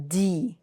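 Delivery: D said softly as "dee". Shown as a demonstration. Teacher shows how D with I sounds, softly.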